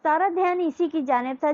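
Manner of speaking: ordinary delivery, nothing marked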